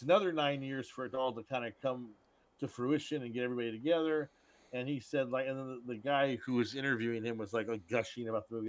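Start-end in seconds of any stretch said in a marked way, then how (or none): none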